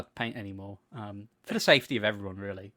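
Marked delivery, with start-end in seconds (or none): none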